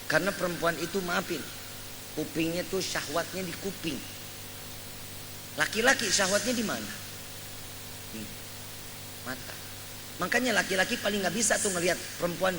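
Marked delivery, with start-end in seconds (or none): none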